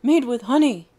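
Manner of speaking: loud, high, spilling the secret; excited reveal. Acted. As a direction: blurt out the secret ingredient. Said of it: "Kobe barked, spilling the secret".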